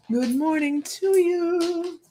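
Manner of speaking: high, slow drawn-out good morning; lilting hello to the viewers; sing-song greeting. Real, not acted.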